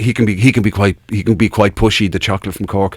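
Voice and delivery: mock patient on couch. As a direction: friend mimicked telling a psychiatrist, low and soft.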